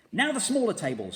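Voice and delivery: high, loud excited command; shouted. A narrator's reading. Mugglewump shouting orders to the birds. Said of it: "shouted Mugglewump".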